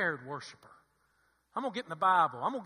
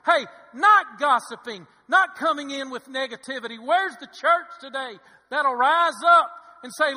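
full-force preaching; 7 semitones higher, 13 dB louder than usual. Acted. Preacher calling the church to praise, loud impassioned preaching. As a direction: hammer out each phrase to rouse the church.

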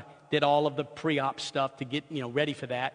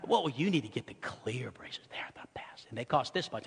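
hushed, secretive whisper of the orthodontist; whispered sales pitch; 6 semitones lower, 9 dB quieter than usual. Retold or imitated. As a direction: lean in and whisper the price like a secret.

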